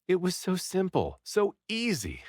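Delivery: high, bright realization; excited. A narrator's reading. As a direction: rush up in sudden excitement.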